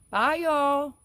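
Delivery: high, loud put-on voice of a friend; her voice. Imitating a friend's high, loud goodbye to the chat. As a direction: pitch it way up and belt a cheery goodbye. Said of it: "she's doing it in her voice like".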